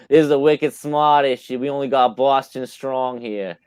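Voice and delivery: badly faked Boston accent, dropping the r's. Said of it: "I can't do a Boston accent".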